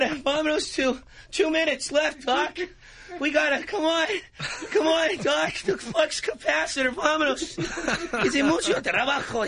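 put-on high, urgent Spanish-accented voice.

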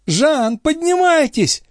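very high-pitched call; urgent high shout, drawn out. A narrator's reading. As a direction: call up to someone at a distance. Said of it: "крикнула ему Шон".

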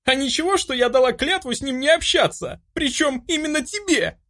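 high, indignant retort; angry high-pitched challenge; sudden spite. A narrator's reading. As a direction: throw it in his face, outraged. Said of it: "от внезапно накатившей злобы".

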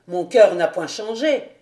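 loud protest from the captain; crying out. A narrator's reading. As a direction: insist forcefully in the officer's voice. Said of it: "s'écria le capitaine".

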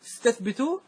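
استثبتوا lifted high with a rising tune; high rising demo of استثبتوا; hamza kept. Shown as a demonstration. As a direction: lift استثبتوا as a starting word. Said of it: "فإذا بدأت به تقول استثبتوا".

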